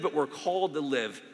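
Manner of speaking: ordinary delivery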